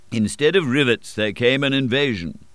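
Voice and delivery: plain reading voice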